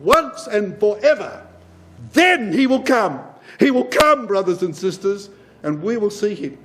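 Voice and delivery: preaching at full force. Acted. Preacher proclaiming the Lord's return to the brethren, fervent. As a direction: preach it out, building to a peak.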